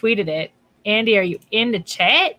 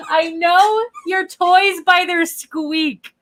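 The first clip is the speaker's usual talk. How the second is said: slow high sing-song line; slow teasing sing-song; 8 semitones higher, much slower than usual. Real, not acted. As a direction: draw it out high and playful.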